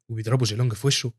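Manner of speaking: plain reading voice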